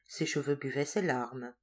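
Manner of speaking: plain reading voice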